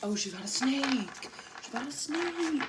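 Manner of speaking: high, startled exclamation; real surprised outburst; excited alarm. Real, not acted. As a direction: call out the shock discovery, pitch climbing. Reacting to neighbor finding a snake.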